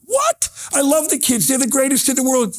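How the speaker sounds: sudden high disbelieving cry, then pleading; shocked protest. Retold or imitated. Protesting to the teacher who bans his visits, shocked. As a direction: voice jumps up in hurt surprise.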